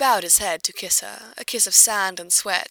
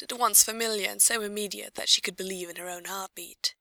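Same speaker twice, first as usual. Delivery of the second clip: ordinary delivery, nothing marked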